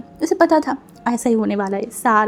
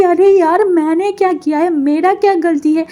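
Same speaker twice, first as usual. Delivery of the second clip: higher and louder frustrated outburst; exasperated protest; 7 semitones higher, 6 dB louder than usual. Acted. Elder sister protesting to her mother, exasperated and loud. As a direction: vent at the unfair blame. Girl protests unfair blame.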